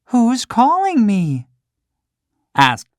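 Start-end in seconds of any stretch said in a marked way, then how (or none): 0.1-1.5 high, startled puppet question; puppet boy's voice. Acted. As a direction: Pinocchio hears a stranger in his room, high puppet voice. Pinocchio's voice.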